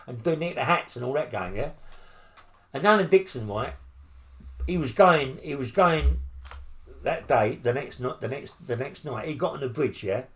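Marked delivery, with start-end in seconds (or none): none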